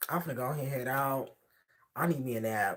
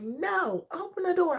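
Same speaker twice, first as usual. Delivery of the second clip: put-on high, whiny kid demand; little girl insisting; 4 dB louder, slower than usual. Daughter refusing her dad, demanding the door open, high. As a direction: insist like a bratty small child. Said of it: "she's like".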